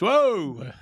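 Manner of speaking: high, loud startled whoa; a kid caught off guard by a swear; surprised whoa. Retold or imitated. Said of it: "Braden goes".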